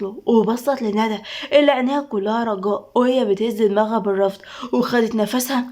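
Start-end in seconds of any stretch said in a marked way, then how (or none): none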